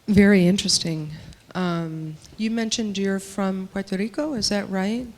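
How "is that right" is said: ordinary delivery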